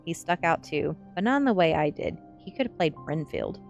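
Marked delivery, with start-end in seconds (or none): none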